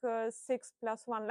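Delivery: normal talking voice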